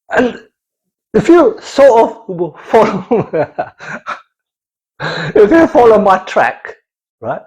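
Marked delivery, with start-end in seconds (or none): none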